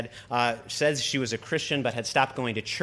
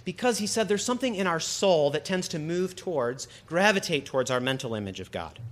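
ordinary talk

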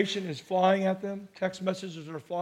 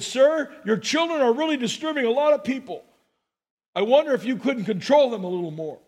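raised, louder, pointed rebuke to a stranger; irritated complaint; 5 semitones higher, 6 dB louder than usual. Retold or imitated. Narrator on a subway, scolding a father over his kids, irritated. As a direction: clear your throat and tell him off.

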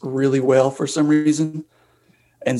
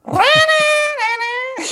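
high wailing sax riff done by voice; saxophone imitation. Breaking out a Bob Seger riff in staff meeting, wailing saxophone imitation. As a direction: wail like a saxophone, high and drawn out. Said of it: "immediately broke out the [name] saxophone".

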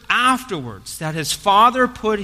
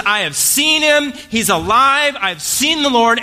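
raised, loud, jubilant announcement; excited proclamation; 6 semitones higher, 4 dB louder than usual. Retold or imitated. Mary runs to tell the disciples, high excited proclamation. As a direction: burst out with the news, high and strong.

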